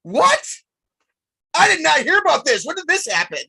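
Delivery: surprised. Real, not acted. Hears surprising contract news.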